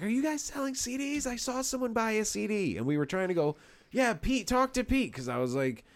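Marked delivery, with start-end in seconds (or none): 0.0-2.8 high-pitched mock girl's voice, asking about CDs; imitating the girl. Retelling the fan at the gig, high mock girl voice. Girl's quote retold in loud bar. 3.9-5.1 high, raised voice, pointing her over to Pete; mock calling out. Telling the fan to ask Pete, high calling voice.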